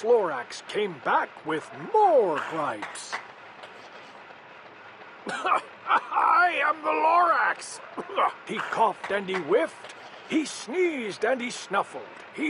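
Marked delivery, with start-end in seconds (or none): 5.3-7.8 slow shout. Acted. Lorax shouting his name at the Onceler. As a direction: announce yourself with force.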